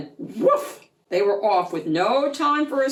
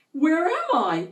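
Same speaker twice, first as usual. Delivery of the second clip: amazed question; 8 semitones higher than usual. Acted. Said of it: "he said".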